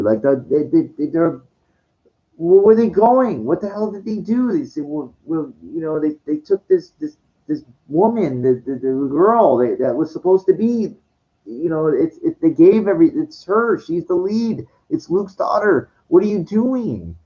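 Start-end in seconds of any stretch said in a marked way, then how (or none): 2.4-4.5 passionate rant. Real, not acted. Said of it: "I'm not angry, but I'm passionate". 16.1-17.2 higher, pleading what are you doing; exasperated question. Real, not acted. Fan ranting at the filmmakers, exasperated and raised pitch. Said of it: "I'm not angry, but I'm passionate".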